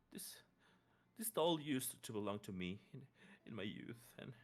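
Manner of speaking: lightly voiced sorrowful old woman; grieving mother.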